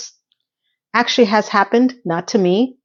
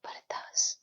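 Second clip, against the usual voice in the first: very soft, voiceless whisper; whispered aside; 19 dB quieter than usual. Real, not acted.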